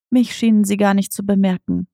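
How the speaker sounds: ordinary delivery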